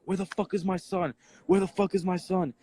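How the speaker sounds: high-pitched urgent demand, retold; distraught stepfather. Eyewitness quoting stepdad looking for his son, imitated distress. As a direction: imitate a frantic parent demanding answers. Stepdad arriving at shooting scene.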